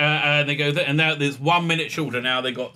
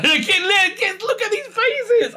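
high laughing disbelief; 3 dB louder than usual. Real, not acted. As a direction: squeal it through laughter. Mocking actors' faces.

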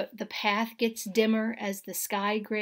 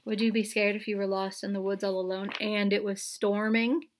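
plain reading voice

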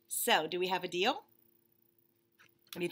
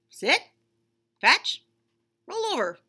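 short louder barked commands, rising; mock dog commands; 9 semitones higher, 9 dB louder, slower than usual. Bunny pretending to train a pet rock, mock commands. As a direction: give orders to a rock.

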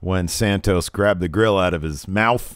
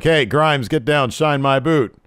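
cocky order; 6 semitones higher, 3 dB louder than usual. Retold or imitated. L A Knight ordering butler.

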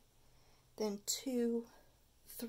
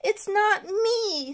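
slow, higher whiny protest; pleading boy; 8 semitones higher, 5 dB louder, slower than usual. Acted. Stuart protesting as he is led away, whiny. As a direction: plead innocence slowly. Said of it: "said Stuart as he was led".

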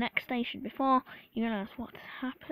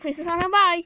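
real raised, high call; 6 semitones higher, 11 dB louder than usual. Real, not acted. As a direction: hurriedly call back to parents, louder. Calling back to parents.

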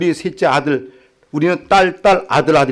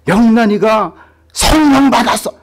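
excited news; 8 semitones higher, 4 dB louder than usual. Retold or imitated. Wife excitedly reporting news.

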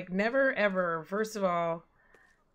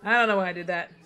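laughing; 6 dB louder, faster than usual. Real, not acted. Laughing at own mistake.

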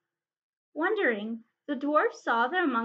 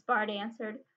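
plain reading voice

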